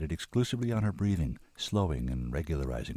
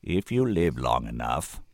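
slow, deliberate senator; 4 semitones lower, 4 dB louder, slower than usual. A narrator's reading. Senator answers at last after a long silence, slowly. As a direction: take your time over each word. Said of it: "the senator said slowly at last".

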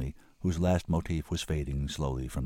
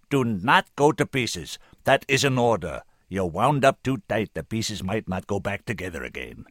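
full-power voice; 4 semitones higher, 7 dB louder than usual. A narrator's reading. Senator orders the woman to hold together, forcefully. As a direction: order it firmly, easing off at the end. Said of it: "He was using the full power of his voice".

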